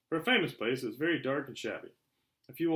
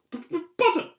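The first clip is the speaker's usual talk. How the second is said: high, loud stutter on the Ps; nervous stammer; 11 dB louder than usual. A narrator's reading. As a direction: stammer like a jittery professor. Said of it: "stammered Professor Quirrell".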